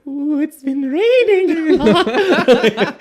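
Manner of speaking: very high, slow, loud plant voice. Retold or imitated. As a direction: croon it like a dreamy plant. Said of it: "what would a plant talk like".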